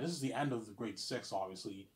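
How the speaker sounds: ordinary talk, nothing marked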